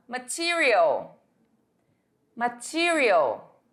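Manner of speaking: Material stretched out slowly in English; slow model word. Shown as a demonstration. Teacher modelling Material slowly for students to repeat. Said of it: "repitam comigo".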